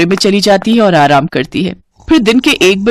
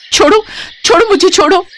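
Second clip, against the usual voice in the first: much higher pitched alarmed shout; frightened protest; 13 semitones higher than usual. Acted. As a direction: push him off in panic. Bride grabbed by milkman.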